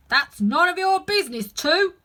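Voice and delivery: loud high gardener voice; gruff retort. Acted. Seven snaps at Two, loud and high. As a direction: snap him off.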